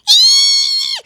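piercing high, loud squeal; sudden long squeal of alarm. A narrator's reading. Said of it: "heard a squeal".

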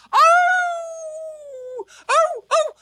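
very high howling oh. Acted. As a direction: howl like a sad dog. Dog howls.

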